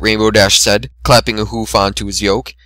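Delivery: plain reading voice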